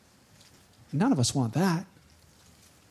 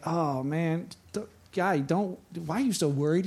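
softer, lower, unbothered reply; calm Elisha; 4 semitones lower, 7 dB quieter than usual. Retold or imitated. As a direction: settle a panicking servant gently. Calm Elisha answers panicked servant.

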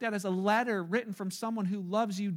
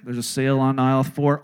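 much lower, louder announcer voice; store intercom; 9 semitones lower, 10 dB louder than usual. Pretend. Preacher voicing a Walmart loudspeaker answering, low and loud.

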